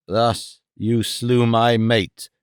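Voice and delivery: slow, low, menacing; a deadly slow threat to the killer; terrible hiss. Acted. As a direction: drag out each word like a blade. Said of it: "he hissed in a terrible voice".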